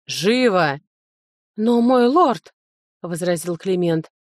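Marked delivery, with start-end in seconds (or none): 0.1-0.8 restrained commanding bark; a touch of a bark, no rise in pitch; barked order. A narrator's reading. Lord hurries the steward, restrained barked order. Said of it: "рявкнул лорд". 1.6-2.5 protesting servant. A narrator's reading. Steward objects to the lord's order.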